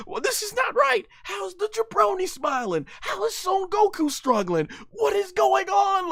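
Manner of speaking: high, puzzled Goku voice; confused Goku. Retold or imitated. Goku confused by the jabroni, voiced high and puzzled. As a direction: wonder aloud, lost. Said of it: "look at Goku, like".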